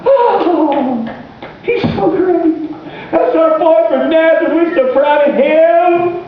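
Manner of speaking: high, slow, mock-gushing praise; proud neighbors praising Jesus in high, slow, mock gushing; mocking hometown crowd. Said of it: "they asked".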